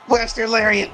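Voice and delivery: high-pitched commentator's shout-out of a move; excited move call. Real, not acted. Calling the lariat as it lands in the match, excited.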